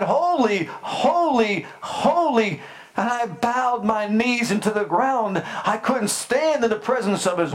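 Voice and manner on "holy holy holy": angels' cry. Retold or imitated. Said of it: "The angels cried".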